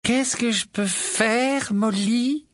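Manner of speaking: put-on high, slow eager young witch; eager Tonk. Tonk offering Molly help, eager. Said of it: "avec enthousiasme".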